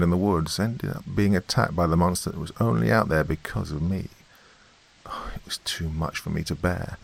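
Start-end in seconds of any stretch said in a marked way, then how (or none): 5.1-7.0 softly whispered overwhelm; whispered despair. A narrator's reading. Narrator whispering her fear, overwhelmed. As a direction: breathe it out hopelessly.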